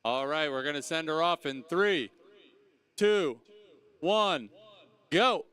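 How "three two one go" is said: loud starting call; called out countdown. Real, not acted.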